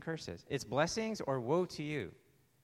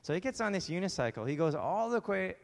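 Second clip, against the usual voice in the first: normal talking voice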